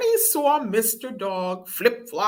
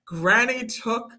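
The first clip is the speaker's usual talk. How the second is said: plain reading voice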